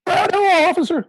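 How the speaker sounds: high, loud bystander on the news; high mock witness voice. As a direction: ham it up like a TV witness.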